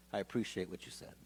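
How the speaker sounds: a grudging, hushed apology; guard thanks him softly after holding the phone; quiet thanks. Retold or imitated.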